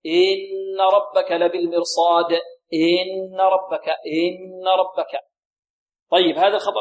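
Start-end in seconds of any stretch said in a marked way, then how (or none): 0.0-5.2 إن ربك with the kasra of إن held long, slow; إن ربك dragged at the hamza; stretched kasra. Shown as a demonstration. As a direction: mimic a student lengthening إن. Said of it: "يقول هكذا".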